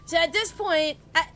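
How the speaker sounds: usual speaking voice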